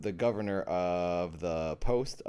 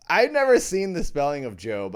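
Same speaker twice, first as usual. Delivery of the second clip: laughing; 7 semitones higher, 10 dB louder than usual. Real, not acted. Laughing at spelling.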